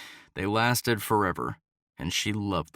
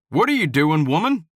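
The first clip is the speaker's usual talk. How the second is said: high, loud accusing demand; 6 semitones higher, 7 dB louder than usual. Acted. Hunter confronts her as she flees, loud accusing. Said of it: "His tone was accusing".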